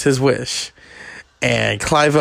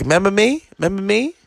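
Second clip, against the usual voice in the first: high-pitched [name] squeal; a hint of [name] yelping it out; higher mock [name], asking twice; 8 semitones higher, faster than usual. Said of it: "he's like".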